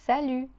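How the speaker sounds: salut with a silent T; teacher models salut; French salut. Shown as a demonstration. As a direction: drop the T in salut. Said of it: "you would say".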